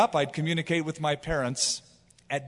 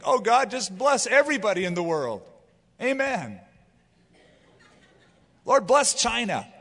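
vague beginner prayer; 5 semitones higher than usual. Retold or imitated.

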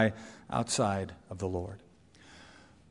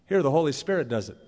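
ordinary talk, nothing marked

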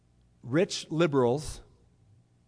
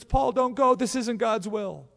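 pleading; 8 semitones higher, 4 dB louder, slower than usual. Acted. Christians begging Paul.

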